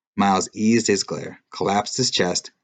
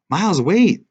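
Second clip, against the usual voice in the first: high, loud urgent call; 11 semitones higher, 9 dB louder than usual. A narrator's reading. Girl calls after the fleeing boy, loud and urgent. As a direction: make him stop, shout it. Chasing him, calling out.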